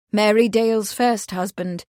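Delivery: ordinary delivery, nothing marked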